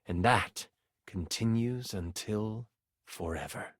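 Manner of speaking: low, soft, solemn narration; hushed story ending. A narrator's reading. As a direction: let the tale sink away, low and soft. Game master closes the story.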